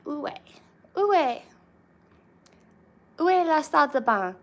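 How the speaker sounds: loud, high French phrase; French accent. Shown as a demonstration. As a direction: call it out in a put-on French voice. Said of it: "In French would be".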